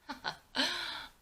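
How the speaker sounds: high mocking laugh; scornful laugh. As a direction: laugh at him with contempt. Son scoffing at father.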